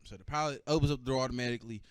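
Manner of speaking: ordinary talk, nothing marked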